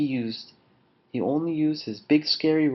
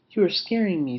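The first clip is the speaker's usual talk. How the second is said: higher-pitched little bat; 6 semitones higher, faster than usual. A narrator's reading. Bat tells Lion he is scary, little voice. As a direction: complain in a small, high voice. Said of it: "heard a little voice".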